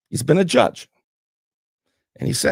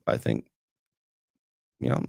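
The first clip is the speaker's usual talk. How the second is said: normal talking voice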